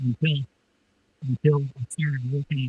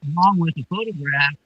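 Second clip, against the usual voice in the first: usual speaking voice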